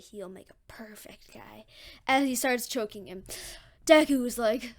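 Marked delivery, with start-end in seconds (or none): 0.0-1.6 soft, slow whispered creature voice; slime monster. Acted. Slime monster eyeing its victim, creepy whisper. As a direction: whisper it slowly like a lurking monster.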